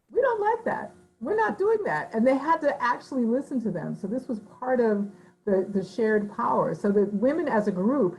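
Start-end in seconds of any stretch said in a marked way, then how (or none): 0.1-2.1 much higher, firm mock refusal; leader woman's refusal. Leader woman refusing the council, high firm voice. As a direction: put your foot down as the head woman. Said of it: "the sort of leader woman would say".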